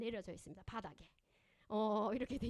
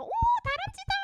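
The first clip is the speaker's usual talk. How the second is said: much higher, louder gushing cry; delighted neighbor mom; 10 dB louder than usual. Retold or imitated. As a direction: gush like a mom thrilled to see a kid climb. Neighbor mom delighted at climbing kid. (나무 타는 아이 보고 감탄하는 옆집 엄마, 높고 크게 들뜬 목소리)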